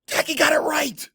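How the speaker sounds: high, loud, delighted gasp; excited. A narrator's reading. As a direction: gasp with joy at success.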